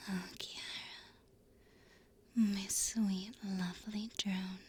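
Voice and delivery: unvoiced whisper of praise. Real, not acted. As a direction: whisper it close to the mic. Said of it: "I can whisper you some names".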